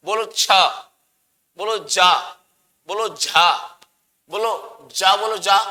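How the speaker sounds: छ, जा, झा said with tongue on the palate; palatal sounds demo. Shown as a demonstration. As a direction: model each palatal syllable for students. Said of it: "बोल के दिखाओ च।".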